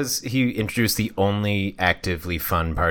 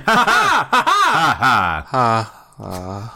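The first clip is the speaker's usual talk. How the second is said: anime laugh; much slower than usual. Acted.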